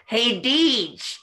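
high, loud goofy sidekick voice, slowing at the end; high and dopey, like the sidekick in Mr. Deeds; Adam Sandler sidekick impression. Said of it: "And Deeds, when he's like".

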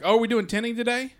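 mild mock surprise. Salesman asks customer about tinting, in mock surprise. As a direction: act caught off guard, voice jumping up.

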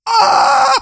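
high, loud anguished scream; anguished shout. Real, not acted. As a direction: scream out in frustration. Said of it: "The aggression".

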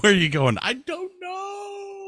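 high squeaky hammed-up cry of a man strapped to jet engines; mock panicked flyer. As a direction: squeal it out in a tiny high voice, clueless. Mock flight joke, high voice.

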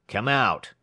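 rough tramp giving orders; tramp ordering. A narrator's reading. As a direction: order him out like a gruff hobo. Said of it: "ordered the tramp".

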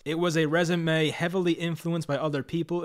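ordinary delivery

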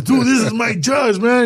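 high, slow, emphatic friend voice; imitated urgent pleading. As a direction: act out a friend talking sense into you. Voicing his friend urging him to go.

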